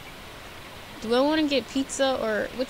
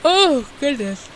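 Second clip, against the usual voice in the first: talking through a yawn; 4 semitones higher, 7 dB louder, slower than usual. Real, not acted. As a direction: yawn mid-word. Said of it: "I keep yawning like crazy".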